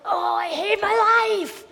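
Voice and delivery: mock-tantrum wail; hammed-up angry despair; angry whine. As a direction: moan it in a melodramatic huff. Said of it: "Jonah's really angry again".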